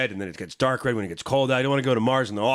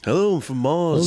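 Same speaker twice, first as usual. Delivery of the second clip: high, slow mock [name] accent; 6 semitones higher, 4 dB louder, slower than usual. Host greets as a Martian Beatle, mock [name] accent. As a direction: put on a Beatle's Liverpool accent. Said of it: "Could be a [name] sort of".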